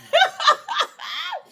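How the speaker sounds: bursting into a high, loud laugh; cracking up at the memory. Real, not acted. Laughs at Dee Dee going off.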